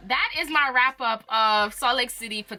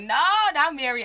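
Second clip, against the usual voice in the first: retold scream; 7 semitones higher, 5 dB louder than usual. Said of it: "I was like".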